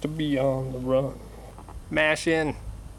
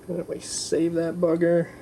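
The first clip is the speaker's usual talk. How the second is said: ordinary talk, nothing marked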